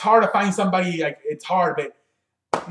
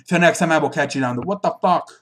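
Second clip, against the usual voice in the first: ordinary talk, nothing marked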